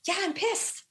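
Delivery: high, heated mock anger, slowed on the middle line; reliving the frustration, voice pushed up high; half-acted fury at the teacher; pissed off. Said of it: "You sound angry".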